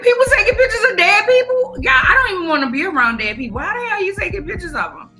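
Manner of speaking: high-pitched, rushed outburst of disbelief; horrified squeal of a reaction; appalled disbelief. Retold or imitated. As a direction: react aghast in a high, incredulous voice. Acted shocked reaction to the news.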